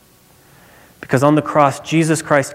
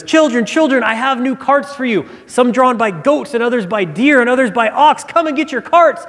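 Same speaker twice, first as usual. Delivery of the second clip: calling out; 9 semitones higher than usual. Retold or imitated. Father calling his children with new toys, excited shout. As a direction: call the kids out with the promise of new carts. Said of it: "he starts yelling and he says".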